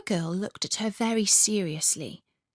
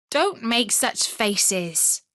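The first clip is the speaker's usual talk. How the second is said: slow scolding; 3 semitones higher, much slower than usual. Acted. Robber girl scolds Gerda.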